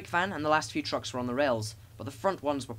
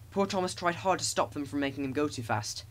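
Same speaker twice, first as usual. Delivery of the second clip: ordinary delivery, nothing marked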